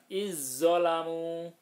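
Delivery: "Izzolamu" chanted slow and loud with a long doubled z; merged za into zo. Shown as a demonstration. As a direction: recite "Izzolamu" holding the heavy z, vowels drawn out. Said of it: "zo-nya ditasdidkan".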